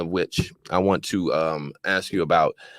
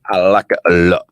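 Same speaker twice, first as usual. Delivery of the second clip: louder, showy British accent on the line; 10 dB louder than usual. Shown as a demonstration. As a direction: put on a posh-sounding British accent for fun. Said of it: "I do this British ac-, accent".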